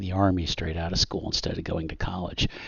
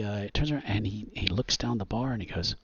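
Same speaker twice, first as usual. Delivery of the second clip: normal talking voice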